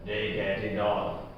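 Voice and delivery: slow, soft and low; quiet resentful grumble; bitter. A narrator's reading. Old bootmaker lamenting the big firms, bitter. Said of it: "he spoke long and bitterly".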